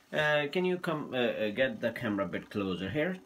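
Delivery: usual speaking voice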